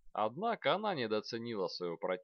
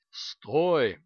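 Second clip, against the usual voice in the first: loud, booming command; roaring dragon; 4 semitones higher, 11 dB louder than usual. A narrator's reading. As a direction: roar it like a dragon. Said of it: "взревел дракон".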